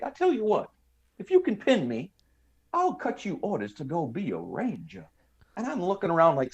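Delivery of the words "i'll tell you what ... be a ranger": high mock Southern drawl, getting softer. Clerk offering orders if recruit pins him, mock Southern drawl. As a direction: propose it in a lilting, exaggerated Southern drawl. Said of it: "that Southern drawl accent".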